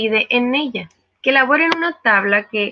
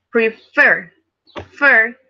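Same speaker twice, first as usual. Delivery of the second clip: Prefer with FER stressed, then FER alone, louder; 4 dB louder, much slower than usual. Shown as a demonstration. Teacher showing students the tonic syllable, stress on Fer. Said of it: "es la sílaba tónica".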